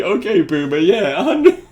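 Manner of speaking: giggling through a dismissive quote; laughing mock dismissal. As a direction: laugh while brushing someone off.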